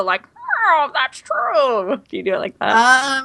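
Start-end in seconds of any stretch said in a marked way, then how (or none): 0.4-2.0 high, swooping put-on voice; character voice. Shown as a demonstration. Asking a friend about doing the voices, put-on character voice. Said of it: "Do you do the voices, though?".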